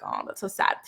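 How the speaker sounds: soft unvoiced whisper, a touch of sadness; whispered pity. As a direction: breathe it out like a sympathetic sigh. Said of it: "And I'm like".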